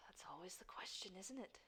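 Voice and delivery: low, sighing shadow whisper; dark whisper behind you. Acted. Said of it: "it's the Shadow's voice".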